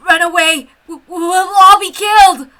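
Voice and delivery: high, loud stammering cry; panicked colleague yelling to run; terrified warning. Acted. Colleague fleeing in terror.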